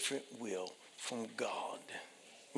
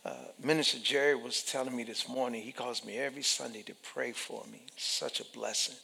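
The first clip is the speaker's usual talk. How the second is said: normal talking voice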